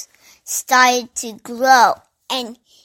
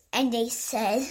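usual speaking voice

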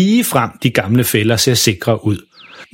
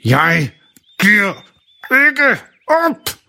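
through gritted teeth; 8 semitones higher, much slower than usual. Acted. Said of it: "med sammenbidte tænder".

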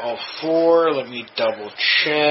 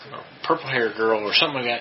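ordinary delivery

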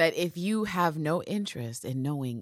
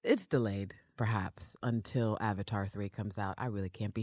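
whispering; 9 semitones lower, 7 dB quieter than usual. Real, not acted. Joking aside whispered.

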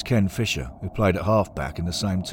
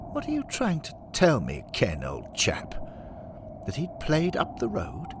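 incredulous club father; 5 semitones higher than usual. Acted. Henry hears Billy played for the rivals, pompous disbelief. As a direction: question it as if it's nonsense.

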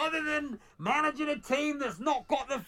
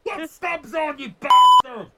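furious fan shouting down at the touchline; yelling at the manager; 4 semitones higher, 8 dB louder, slower than usual. Retold or imitated. Fan at the match screams at manager Johnson, furious yell. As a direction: bellow it from the stands, the last word a full scream. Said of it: "Anyway, I shouted at him".

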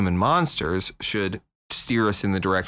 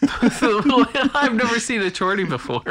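laughing; 12 semitones higher than usual. Real, not acted. Cracking up mid-sentence.

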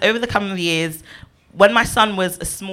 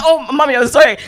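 higher, louder imitated pleading; mock frightened apology; 7 semitones higher, 7 dB louder than usual. Grown child apologizing to scary mum, mock fright. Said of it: "you jump like".

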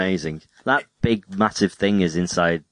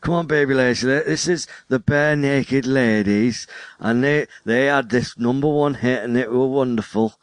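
mock northern accent; 5 semitones higher than usual. Imagined dad introducing music to his baby, mock northern accent. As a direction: present the band in put-on northern. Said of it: "I don't know why you're northern".

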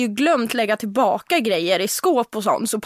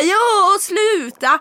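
mock protest; 8 semitones higher, 4 dB louder, much slower than usual. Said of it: "Jag bara".

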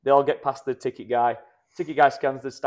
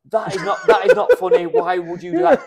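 louder, indignant scolding; mock outraged commenter; 4 semitones higher, 7 dB louder than usual. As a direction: ham up a preachy online commenter. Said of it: "Went to the comment section".

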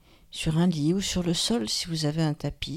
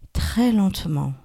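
ordinary talk, nothing marked

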